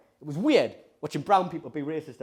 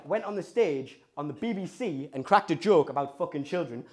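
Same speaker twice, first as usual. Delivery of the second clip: ordinary delivery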